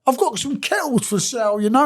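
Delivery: high-pitched sales patter; put-on voice of a hapless salesman; imitating a workmate. Workmate pretending to sell kettles, imitated high patter. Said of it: "this is how he talks".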